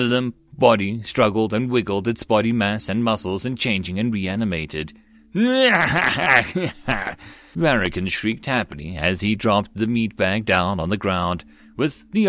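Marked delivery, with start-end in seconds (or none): 5.3-7.1 evil cackle. A narrator's reading. Varikan shrieks happily.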